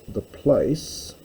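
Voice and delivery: normal talking voice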